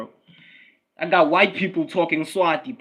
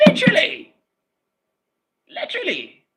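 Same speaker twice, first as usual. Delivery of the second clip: laughing squeal; 3 dB louder than usual. Real, not acted. Laughing high after joke.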